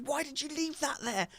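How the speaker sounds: high, soft scolding voice; imitating Vivi. Dad imitating daughter scolding him, high and soft. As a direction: squeak it up, mock scolding. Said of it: "She goes".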